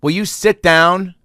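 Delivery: high, loud, slow irritated demand; man behind at concert, loud high annoyed demand. Retold or imitated. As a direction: snap at the dancers to sit down. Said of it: "he just goes".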